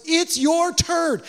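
high, drawn-out proclamation; rousing call. Retold or imitated. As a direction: call the disciples to action, high and slow. Jesus' call to disciples, voiced.

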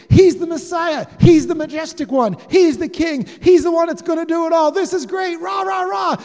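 high, shouted crowd cheering; all excited. Retold or imitated. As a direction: cheer like overjoyed followers, loud and high. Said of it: "they're all excited".

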